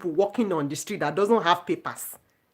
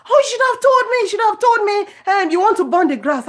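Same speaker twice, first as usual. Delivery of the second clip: much higher pitched put-on neighbor voice; neighbor complaining; 10 semitones higher than usual. Neighbor telling her she should have warned first, high mock voice. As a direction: squeak out her gentle complaint. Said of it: "And she was like".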